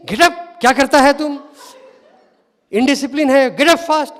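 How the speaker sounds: high, loud commands with a laugh; mock scolding. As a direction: order the boy up, hammed-up. Swami retold scolding the trapped boy.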